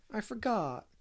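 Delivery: put-on high-pitched little boy's whimper; acted childish whimper. A narrator's reading. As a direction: plead small and high like a scolded child. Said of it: "whimpered Davy".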